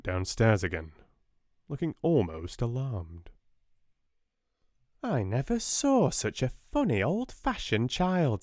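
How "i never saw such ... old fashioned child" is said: high, flustered spinster voice; timid sister reporting, high and fluttery; fussy Miss Amelia. Acted. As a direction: sound a bit alarmed and fussy.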